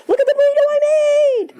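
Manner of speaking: mocking someone posting their burrito online; mock show-off poster. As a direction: squeal it like a proud food poster. Mocking social media poster.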